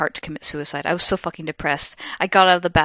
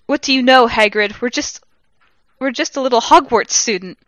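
higher, louder whiny protest; indignant protest; 5 semitones higher, 5 dB louder than usual. Acted. Student snapping back at Hagrid, loud and higher. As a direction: talk back at the big man.

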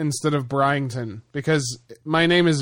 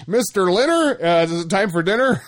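teasing call; 4 semitones higher than usual. Pretend. Teasing name mix-up.

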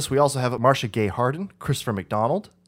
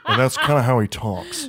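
lightly put-on gruff actor impression; mock gruff voice, a bit forceful; Ted Levine; 4 semitones higher, 4 dB louder than usual. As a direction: imitate a gruff talker whose lower jaw doesn't move. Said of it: "really gruff and, like, low".

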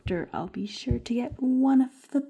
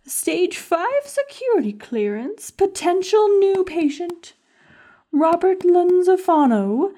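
loud, higher, slow proclaiming of the note; dramatic reading; 3 semitones higher, 4 dB louder, slower than usual. Acted. Schemer reads the doctor's note aloud, loud and grand. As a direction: read it out like a grand announcement.

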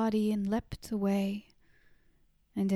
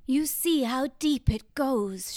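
a touch of a raised shout; mild shout; 4 semitones higher, 4 dB louder than usual. A narrator's reading. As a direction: call it out with some force. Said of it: "shouted the woman".

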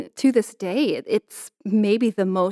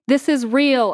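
raised, higher narrated shout; 6 semitones higher, 4 dB louder than usual. A narrator's reading. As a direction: shout it out as a declaration. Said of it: "I shout".

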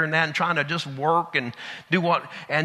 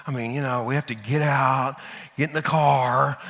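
lower, softer sigh-laden excuses; reluctant sighing; 7 semitones lower, 5 dB quieter than usual. Retold or imitated. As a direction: sigh and drag through the excuse. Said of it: "you're just reluctant to do".

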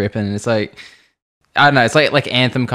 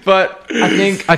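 mock angry; 9 semitones higher, slower than usual. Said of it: "so fucking angry at you".

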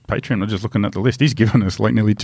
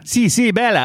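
placating husband in mock Italian; high put-on Italian, dropping at the end; Italian accent; 11 semitones higher than usual. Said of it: "try and do an Italian accent".

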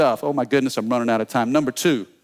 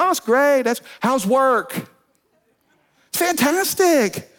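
innocent dad; 6 semitones higher than usual. Retold or imitated. Dad voiced in retold phone call.

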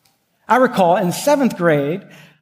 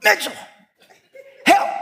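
retold cry for help; 11 semitones higher than usual. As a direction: mock-desperate call to a friend. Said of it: "And I'm going".

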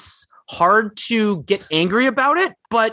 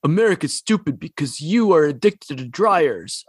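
mocking obnoxious tweet. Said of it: "obnoxious people who are like".

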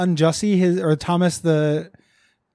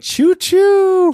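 high loud train whistle choo choo; chugging toy train voice; 13 semitones higher, 8 dB louder than usual. Pretend. Train joke, choo choo call.

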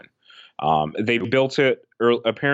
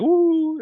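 high, loud imitated whoop; Jay Lethal's Flair-style woo; 6 dB louder than usual. Co-host imitating rival's answer from the ramp, high whoop. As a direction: echo a wrestler's signature "woo", high and held. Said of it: "and he's like".

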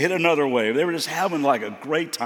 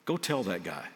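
soft inner voice; 6 semitones lower, 6 dB quieter than usual. Acted. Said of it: "the Holy Spirit speak deep to me".

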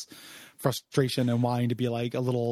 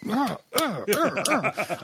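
hoarse whisper; 9 semitones higher, much slower than usual. Shown as a demonstration. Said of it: "I think that would be like".

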